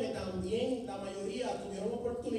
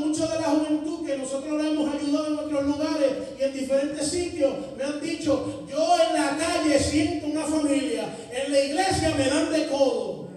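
full-force preaching; 6 semitones higher, 13 dB louder than usual. Acted. Preacher urging the youth, at full force. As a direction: drive it home to the congregation.

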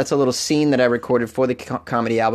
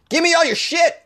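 very high shouted command; hammed-up stickup shout; mock gunman yell. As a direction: bark it like a cartoon robber.

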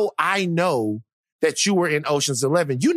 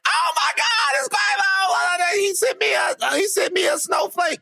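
starstruck squeal; 13 semitones higher than usual. Retold or imitated. Teasing his cohost, a squealing fangirl. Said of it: "you wouldn't have been like".

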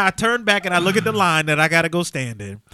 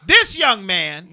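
each word drawn out and louder; slow announcing; 6 dB louder, much slower than usual. Retold or imitated. Principal presenting the freshman to the crowd, slow and drawn out. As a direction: spell out "This young man" slowly and loudly, like a presentation. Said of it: "walks over and goes".

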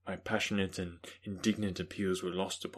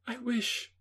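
high, youthful woman's voice; young Elizabeth. Acted. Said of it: "cried she".

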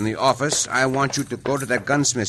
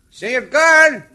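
calling out; 12 semitones higher, 7 dB louder, slower than usual. Acted. Calling out to guard.

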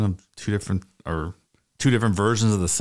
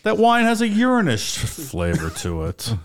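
snooty wine critic; 9 semitones higher than usual. Pretend. Mock wine snob.

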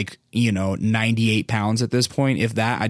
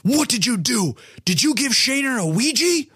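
stern gym teacher; 12 semitones higher than usual. Retold or imitated. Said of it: "he goes".

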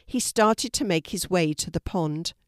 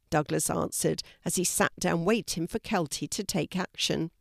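plain reading voice

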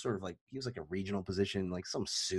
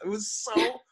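much higher, louder amused gush; excited, laughing retelling; 8 semitones higher, 5 dB louder than usual. Real, not acted. Teller recaps a funny story to friends, high and excited. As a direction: gush about it, laughing and pitched way up.

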